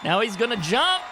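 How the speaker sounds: lively play-by-play call; wrestling commentator. Acted. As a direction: call the match like a TV announcer. Calls the wrestling match.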